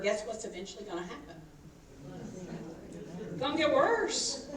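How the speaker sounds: ordinary talk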